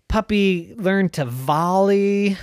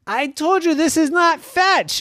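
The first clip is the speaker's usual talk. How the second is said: scolding; 13 semitones higher, 5 dB louder than usual. Acted. Puppy scolding again.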